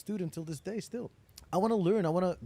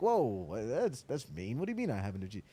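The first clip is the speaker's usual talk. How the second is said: taken aback; 6 semitones lower than usual. Retold or imitated. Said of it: "I'm like".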